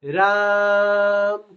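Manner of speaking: long drawn-out call of राम. Shown as a demonstration. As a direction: call राम across a distance, stretching it. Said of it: "किसी को पुकारने का भी काम हो रहा है".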